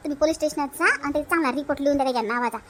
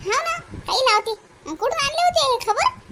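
much higher, disbelieving questions; incredulous; 9 semitones higher than usual. Acted. Neighbour doubting the gossip, high and incredulous.

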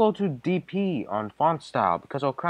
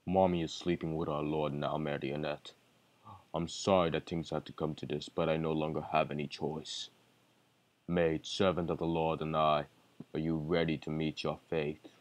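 deep villain voice; 9 semitones lower, 5 dB quieter, faster than usual. Acted. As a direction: speak as a cold deep villain. Villain character lines.

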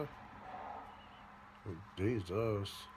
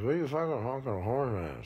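ordinary talk, nothing marked

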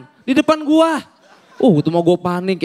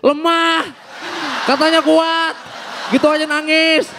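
jeering crowd; 6 semitones higher than usual. Retold or imitated. Waiting patients jeering back.

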